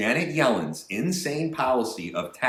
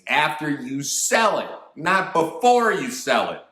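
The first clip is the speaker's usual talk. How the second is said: "after" and "before" punched higher and louder; stress on after and before; 7 semitones higher, 5 dB louder than usual. Acted. Commentator contrasting after and before, loud and high. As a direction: contrast the two words hard.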